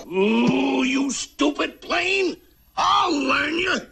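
high, slow angry scolding; furious. Acted. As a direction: lose your temper at the plane. Furious at the stalled plane.

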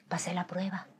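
hushed, breath-only triumph; soft whisper after an ordeal; whispered relief. Retold or imitated. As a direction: whisper it to yourself. Retold private relief.

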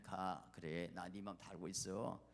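low, soft consolation; understanding divine reply. Acted. God tells the hurt believer he knows their heart, tender. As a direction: drop down low and reassure.

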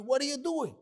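high-pitched exasperated question; acted exasperation; agitated questioning. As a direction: demand an answer with the pitch shooting up. Boss scolds missing regular.

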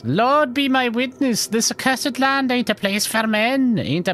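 high, impassioned put-on brogue; leprechaun-like old lady. Chicken lady protesting the accursed land, high leprechaun voice. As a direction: lament it as a leprechaun crone. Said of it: "chicken lady leprechaun".

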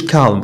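"calm" with the L pronounced, kal-um; L sounded. Shown as a demonstration. Teacher shows learners the mistake, L sounded in "calm". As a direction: say "calm" with an audible L before M. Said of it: "ما تنقولوش calm".

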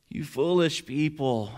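slow, mildly exasperated rebuke; scolding. Acted. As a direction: stretch out each word in mock reproach. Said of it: "Paul's like".

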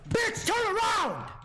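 high loud slow yell; shout. Real, not acted. Player yelling at his character to turn around, loud high. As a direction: scream a warning at the screen.

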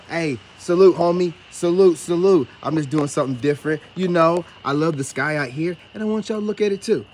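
booming outdoor greeting; loud greeting. Real, not acted. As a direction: call out loudly across the street. Greeting passerby outdoors loudly.

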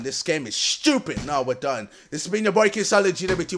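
ordinary talk

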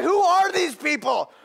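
loud, high, drawn-out question; re-enacted incredulous shout; excited bafflement. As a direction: holler it like an amazed fan. Retells his excitement at game.

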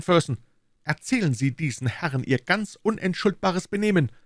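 a self-satisfied detective pleased with himself; Lestrade, smug inspector. Acted. Lestrade voiced by narrator.

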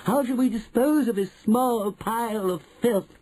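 high-pitched coven voice; witches crying out. A narrator's reading. Witches asking their leader about the boy, high cry. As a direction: call it out high, as one of the mob. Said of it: "they cried out".

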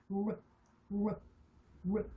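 르 as "rrr", tongue curled without touching the palate; rolled-back English R. Shown as a demonstration. Teacher showing learners the R sound, curled-tongue 르. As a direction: curl the tongue back on 르, no tap. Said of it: "동그랗게 마는".

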